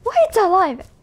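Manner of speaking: loud high shocked cry. Real, not acted. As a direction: yell it in shock. Shocked at game.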